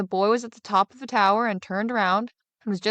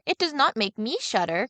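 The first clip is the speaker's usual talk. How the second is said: put-on higher, lightly puzzled boy's voice; boy mocking what others always say, higher and puzzled; simple younger son; 7 semitones higher than usual. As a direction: play the naive lad wondering aloud.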